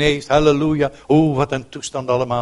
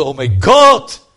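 anguished cry; 8 dB louder, slower than usual. Real, not acted.

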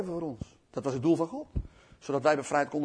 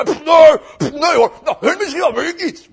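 high, loud dismissive puffing; pooh-pooh it as cocky Peter; hammed-up indignant denial; scoffing boast; 9 semitones higher, 15 dB louder than usual. Peter scoffs at warning, acted.

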